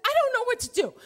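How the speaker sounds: high, loud helpless wail; panicked outburst. Retold or imitated. Rider at a loss as her dates pull ahead, panicking. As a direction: blurt it out high in mock despair.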